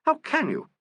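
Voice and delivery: very high wail of dismay; wailing reproach. A narrator's reading. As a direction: wail it in hurt disbelief. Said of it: "wailed Miss Emerald".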